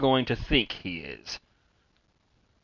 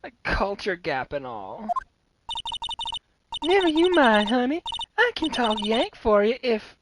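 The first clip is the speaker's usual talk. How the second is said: chirpy country gal perking up at a murder; Southern Lotta voice; 12 semitones higher than usual. Acted. Lotta hears about a murder, high eager Southern twang.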